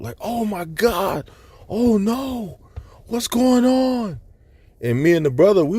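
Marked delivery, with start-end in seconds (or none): 0.2-4.2 high, drawn-out mock panic wail; mock freaking out. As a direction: imitate a panicked crowd, pitched way up and slow. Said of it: "was freaking out".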